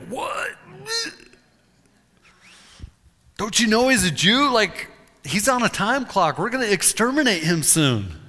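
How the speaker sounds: high, loud cry of indignation; outraged protest. Retold or imitated. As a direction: shriek in disbelief, then rage. Haman outraged at the king's order.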